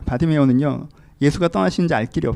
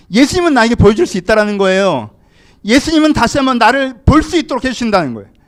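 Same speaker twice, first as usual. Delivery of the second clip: loud, high, urgent preaching voice; forceful preaching; 8 semitones higher, 10 dB louder than usual. Real, not acted. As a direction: preach it out with raised voice. (힘주어 외치는 설교)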